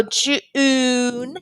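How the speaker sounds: JUNE split and stretched on the oo; long, held oo in June; oo sound shown. Shown as a demonstration. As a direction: draw out June as "Ju-oon". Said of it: "does say the oo sound".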